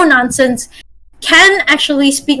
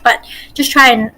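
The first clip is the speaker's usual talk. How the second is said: normal talking voice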